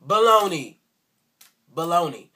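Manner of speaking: loud scornful outburst; loud scoffing baloney. Real, not acted. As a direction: call it out loud, fed up. Scoffs at the made-up claim.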